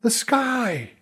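high, urgent yell upward; yelled warning. A narrator's reading. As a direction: cry out a warning to the sky. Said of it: "I heard her when she yelled".

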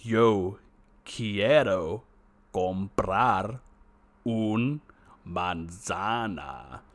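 very slow, over-clear Spanish; slow app voice. Retold or imitated. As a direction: spell each word out like slowed audio. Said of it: "you hit the slow speech version".

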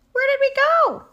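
very high, very loud squawk; squawking chickens. A narrator's reading. Chickens find themselves missing, squawking. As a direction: panicky hen screech. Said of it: "squawked the chickens".

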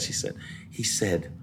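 unvoiced, hushed; whispered. Dad retelling teacher's tale in a hush. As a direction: whisper it like a secret.

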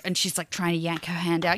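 ordinary talk, nothing marked

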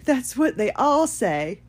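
lightly voiced dismissive staff member; mimicked staff reply. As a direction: brush them off with a slow mock reply. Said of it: "the staff kept saying".

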